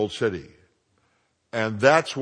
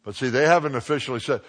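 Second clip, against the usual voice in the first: normal talking voice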